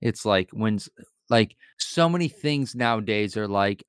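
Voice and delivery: normal talking voice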